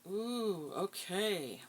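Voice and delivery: high, loud "Ooh, okay"; pleasantly surprised exclamation. Real, not acted. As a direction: react to a surprising card. Reacting to card.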